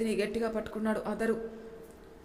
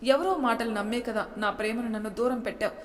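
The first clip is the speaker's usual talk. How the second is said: ordinary delivery, nothing marked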